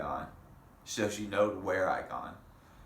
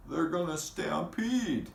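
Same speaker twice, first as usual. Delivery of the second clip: alarmed warning; 9 semitones higher than usual. A narrator's reading. Somebody warns of stampede.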